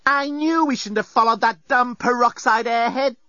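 high whiny self-reproach; guilt-tripping self-blame. Acted. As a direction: lay on the guilt. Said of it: "guilt-provoking self-recrimination".

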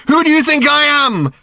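high, loud, furious demand; demanded angrily. A narrator's reading. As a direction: shout it at him, hurt and raging. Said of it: "she demanded angrily".

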